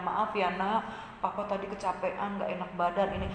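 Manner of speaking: very soft, weary apology; hushed father saying sorry to his child; tired, sorry papa. Acted. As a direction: apologise quietly, worn out. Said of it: "Ketika papanya bilang".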